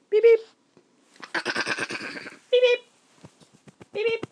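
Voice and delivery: very high squeaky beeps. Acted. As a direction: beep like a toy for the baby. Making silly noises for baby.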